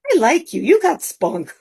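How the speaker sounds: high-pitched, tickled approval; imitated trainer.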